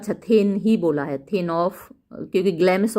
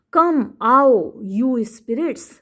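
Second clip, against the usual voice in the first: dramatic reading; 4 semitones higher, much slower than usual. Shown as a demonstration. Reading Lady Macbeth invocation.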